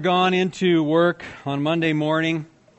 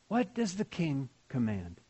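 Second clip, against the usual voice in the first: soft and reverent; 3 semitones lower, 10 dB quieter than usual. Shown as a demonstration. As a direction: let it linger quietly.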